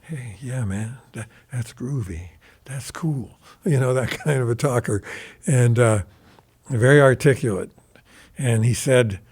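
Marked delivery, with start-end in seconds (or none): 0.0-3.4 much softer, hushed and gentle, easygoing. Retold or imitated. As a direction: murmur it like a gentle rock star, barely above a breath. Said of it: "he spoke real soft, very soft, a gentle speaker".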